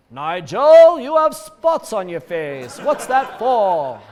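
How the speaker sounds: mock posh English accent, loud and slow; terrible English accent. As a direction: ham up a bad English accent, drawn out. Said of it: "My terrible English accent".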